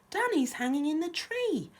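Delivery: high, loud storybook alarm; alarmed cry. A narrator's reading. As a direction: raise the alarm as a little mouse. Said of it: "cried Fieldy".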